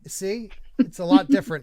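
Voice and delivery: ordinary talk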